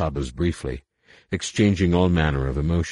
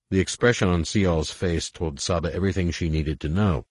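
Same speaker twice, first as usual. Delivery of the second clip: ordinary delivery, nothing marked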